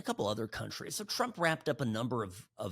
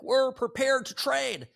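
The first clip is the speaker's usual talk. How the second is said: high, loud, slow put-on voice; mock Carney; 14 semitones higher, 8 dB louder than usual. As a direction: strike a brave pose in a squeaky voice. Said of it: "He's like".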